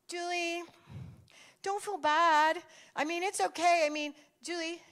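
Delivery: put-on consoling kids. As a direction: imitate kids gently talking her down. Kids consoling her, acted out.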